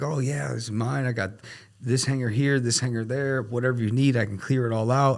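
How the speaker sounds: retold over-eager yes-man; eager pitch. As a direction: sell it hard, promising anything. Retold eager pitch to win client.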